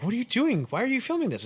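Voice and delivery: high, startled retold question; incredulous mock panic; alarmed.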